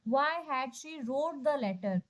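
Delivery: reading the wrong sentence very slowly; slow careful reading. Shown as a demonstration. Teacher slowly reading a wrong option to the class. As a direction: linger on each word. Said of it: "अब देखो इसमें second form लगाया है".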